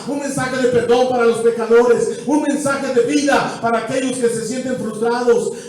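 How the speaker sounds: impassioned preaching. Acted. Preacher proclaiming the gospel of restoration, impassioned. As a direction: lift the congregation with urgency.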